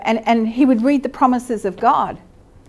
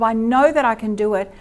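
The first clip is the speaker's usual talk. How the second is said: usual speaking voice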